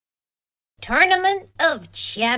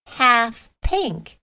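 usual speaking voice

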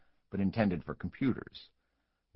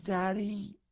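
high, earnest "Daddy?" with a questioning lift; a small girl looking up at her dad solemnly; calm, grave child's "Daddy?"; serious child; 13 semitones higher than usual. A narrator's reading. Little daughter asks her father.

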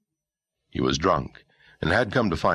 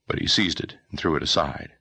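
ordinary delivery

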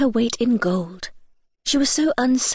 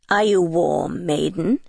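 low, drawn-out King Frost voice; 4 semitones lower than usual. A narrator's reading. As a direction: question her slowly from low in the voice. King Frost questions the freezing girl.

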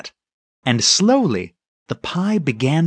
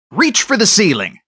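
higher, firm cowboy-style threat; mock hold-up command; 5 semitones higher, 4 dB louder than usual. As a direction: play the sheriff catching a thief. Mother mimics a Western hold-up.

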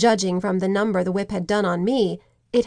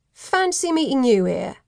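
lightly voiced Cockney thug; cheeky Cockney, higher pitch; Cockney accent; 4 semitones higher than usual. A narrator's reading. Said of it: "a Cockney accent cut through".

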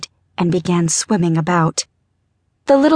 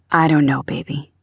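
husband's voice; 5 semitones lower than usual. A narrator's reading. Husband holding his sobbing wife, low voice. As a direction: answer low and helpless.

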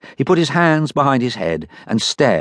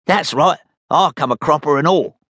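Dud Check's voice; 7 semitones higher than usual. Acted. Dud answers.

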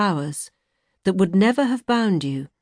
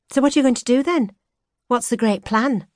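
quick, higher curious prod; brisk bright challenge; eager questioning; 7 semitones higher than usual. Acted. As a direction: push him for the answer. She presses him eagerly.

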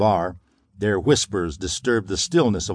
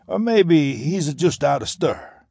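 gangster voice; 7 semitones higher than usual. A narrator's reading. Garino continues speculating.

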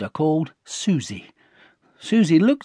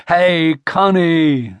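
slow, drawn-out, louder; coaxing call; 3 semitones higher, 5 dB louder, slower than usual. A narrator's reading. Dad prodding the silent Connie, coaxing. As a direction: stretch the name to nudge her. Dad nudging her for a response.

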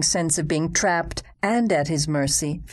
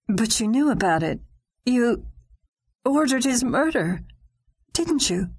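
shocked accusation; 7 semitones higher than usual. Acted. Accusing in disbelief.

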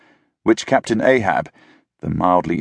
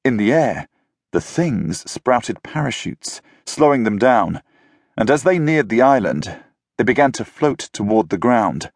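plain reading voice

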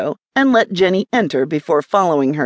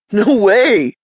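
louder, higher exclamation; punchy, raised-voice exclamation; exclaiming; 4 semitones higher, 5 dB louder than usual. A narrator's reading. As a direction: burst out in emphatic objection. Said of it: "exclaimed Zach".

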